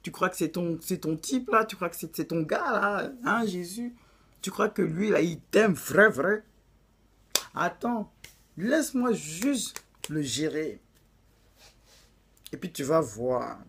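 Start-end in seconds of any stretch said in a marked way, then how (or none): 4.4-13.6 put-on taunting Satan in a light African French accent. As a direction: sneer it with an African accent slipping in. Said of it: "l'accent africain revient".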